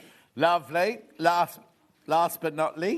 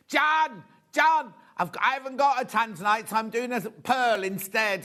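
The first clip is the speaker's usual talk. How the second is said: shouting the name, much higher and louder; loud producer; 6 semitones higher, 5 dB louder than usual. Retold or imitated. Producer shouting to John about the pearl, loud and high. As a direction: call across the studio like an excited producer. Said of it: "She, she goes".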